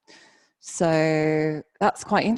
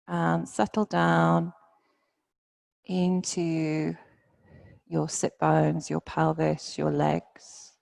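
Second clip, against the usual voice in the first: guided meditation. Real, not acted. Yoga teacher settling students into their bodies, guided meditation. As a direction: ease them down gently, phrase by phrase.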